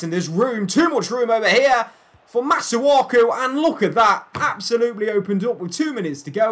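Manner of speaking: high, loud alarm at the open defence; alarmed commentary. Real, not acted. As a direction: cry out as the defence is split. Alarmed call as opponents break through.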